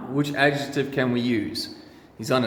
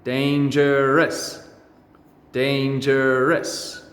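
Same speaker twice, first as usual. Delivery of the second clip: very slow, drawn-out dangerous; slow modelling; much slower than usual. Shown as a demonstration. Teacher modelling the word for pupils, very slow. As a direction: stretch every syllable out slowly. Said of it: "Practice with me".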